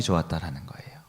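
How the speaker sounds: whisper. Real, not acted. Preacher drops to emphasize.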